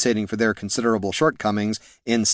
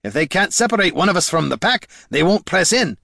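high, projected explanation; hollered; 8 semitones higher than usual. A narrator's reading. Gorm instructing the ringed-in heroes, hollered. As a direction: call it out over the fight. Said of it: "Gorm hollered back".

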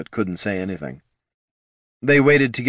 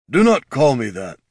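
grumbled; 4 semitones higher, 4 dB louder than usual. A narrator's reading. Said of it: "he grumbled".

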